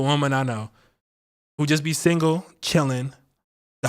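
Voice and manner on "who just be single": ordinary talk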